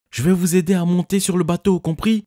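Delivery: very high, loud call; shouting. A narrator's reading. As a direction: shout over the waves. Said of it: "ai-je crié à Arya".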